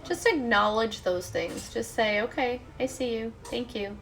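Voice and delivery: soft, unhurried meditation voice; guided meditation. Real, not acted. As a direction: gently guide listeners into letting go.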